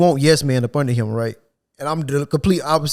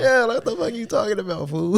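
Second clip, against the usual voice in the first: mock outrage; 9 semitones higher than usual. Mocking manager's phrase.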